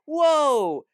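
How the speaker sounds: a parody of staged shock; mock amazement. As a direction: fake a gasp of surprise for the audience. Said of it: "make you as the audience go".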